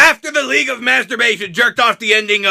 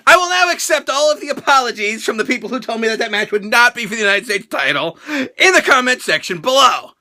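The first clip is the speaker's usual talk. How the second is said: usual speaking voice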